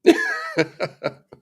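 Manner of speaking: high-pitched burst of laughter. Real, not acted. Laughing at the excuse.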